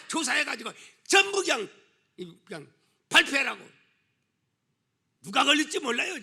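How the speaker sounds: loud, high, impassioned sermon voice; full-force preaching. Real, not acted. Preacher warning the congregation about a widespread scandal, full-force preaching.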